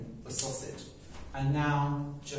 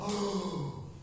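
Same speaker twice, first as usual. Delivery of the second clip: soft gasp; 4 semitones higher, 4 dB quieter than usual. Acted. Bird in shock.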